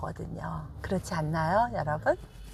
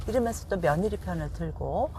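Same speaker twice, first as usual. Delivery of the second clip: normal talking voice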